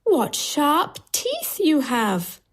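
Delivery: put-on high, drawn-out child exclamation; wondering little girl, high and slow. A narrator's reading. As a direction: exclaim slowly in a high girl's voice. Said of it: "she says".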